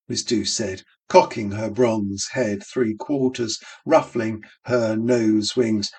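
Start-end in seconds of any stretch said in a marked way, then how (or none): none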